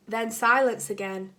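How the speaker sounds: plain reading voice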